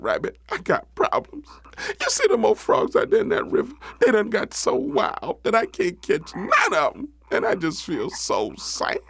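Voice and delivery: mock-miserable storybook raccoon; hammed-up woe, dropping toward the end; sad, miserable raccoon. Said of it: "Coon looking all sad and miserable".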